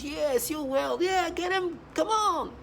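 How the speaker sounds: put-on high sing-song voice, like coaxing a child; fooling a child. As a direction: humour a kid in a high coaxing voice. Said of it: "like when you fool children".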